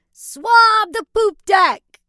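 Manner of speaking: slow loud high pirate order; drawn-out pirate command. Acted. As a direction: bark it like a captain. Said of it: "Use your best pirate voice".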